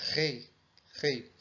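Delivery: "Rei" com o R soprado, como H americano; r aspirado. Shown as a demonstration. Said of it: "um R levemente aspirado". (R aspirado como H)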